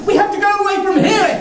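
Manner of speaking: fast, high, breathless hurry; hurried urgency. Acted. Father hurrying his son away, fast and urgent. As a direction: rush the words out.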